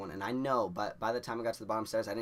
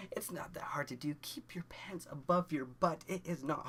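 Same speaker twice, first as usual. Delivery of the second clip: whisper; 5 semitones higher, 3 dB quieter than usual. Real, not acted. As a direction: lean in and whisper the warning. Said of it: "advice to kids, whispered".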